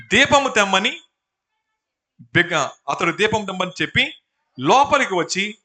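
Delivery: loud, deliberate Bible reading; scripture reading. A narrator's reading. Preacher reading the jailer's story to congregation, loudly declaimed. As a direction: proclaim the passage phrase by phrase.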